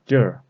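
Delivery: "Jur" as one syllable ending in R; R added. Shown as a demonstration. Teacher shows the northern word for here, R added. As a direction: curl the end of "Jur" into an R. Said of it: "when we add is this R".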